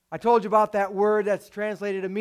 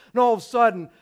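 ordinary talk

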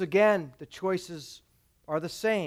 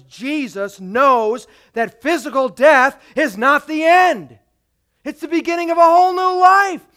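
preached loud and high, slowing into it; a preacher rising to the climax, loud; fervent preaching; 5 semitones higher, 8 dB louder than usual. Acted. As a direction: proclaim it with full conviction. Preaching at full force.

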